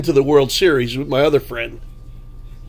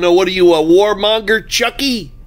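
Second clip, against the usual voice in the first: retold taunting shout at Chucky outside a stadium; retold yelling; 7 semitones higher than usual. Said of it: "I started yelling".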